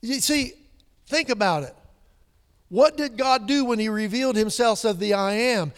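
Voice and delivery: loud, high, driving sermon build; preaching at full force. Acted. Preacher rousing the congregation about deliverance, full-force preaching. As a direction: lift the room with each question.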